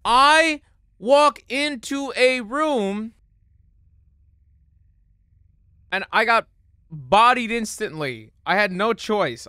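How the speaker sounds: high, loud, emphatic complaint; exasperated venting. Real, not acted. Player killed on entering a room, exasperated. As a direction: spell it out slowly in outrage.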